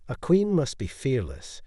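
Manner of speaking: plain reading voice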